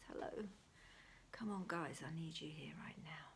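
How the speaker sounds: hushed whisper all through; breathy whisper, barely voiced. Real, not acted. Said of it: "I don't know why I'm whispering".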